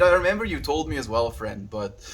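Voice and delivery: usual speaking voice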